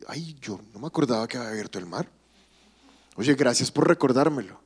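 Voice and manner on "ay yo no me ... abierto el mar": mock God voice. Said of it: "y dije".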